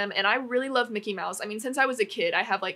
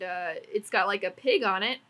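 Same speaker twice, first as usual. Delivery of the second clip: normal talking voice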